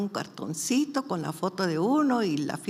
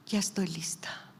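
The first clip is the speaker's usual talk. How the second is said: softly retold surrender, in the usual pitch; resigned prayer; 6 dB quieter than usual. Sick mother praying to the Lord, quiet resignation. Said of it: "Entonces me puse a llorar".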